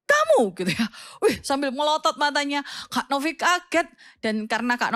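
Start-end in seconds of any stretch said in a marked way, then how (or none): 0.1-0.5 sharp, loud, high scolding call; a teacher catching a cheater; stern teacher's rebuke. Retold or imitated. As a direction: snap the name out like a strict teacher. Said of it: "Tapi dia bilang gini".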